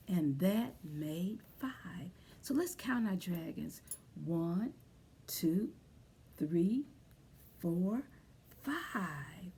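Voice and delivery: soft unvoiced whisper. Teacher whispering to the class, hushed. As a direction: hush it like a secret.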